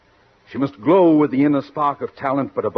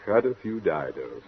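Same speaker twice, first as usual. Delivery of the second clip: much softer, lower, amused repeat; muttered to himself; 8 semitones lower, 7 dB quieter than usual. Real, not acted. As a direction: savour the odd phrase quietly.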